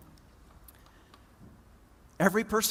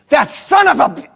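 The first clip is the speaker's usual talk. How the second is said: higher, louder, bitten off mid-word; furious curse; 9 semitones higher, 14 dB louder than usual. Retold or imitated. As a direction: spit it at the governor and stop short. Angry farmer curses the governor.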